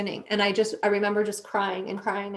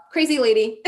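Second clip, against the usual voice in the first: louder, with a laugh breaking out; amused; 4 semitones higher, 7 dB louder than usual. Retold or imitated. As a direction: laugh at yourself as you say it. Laughing at her own outburst.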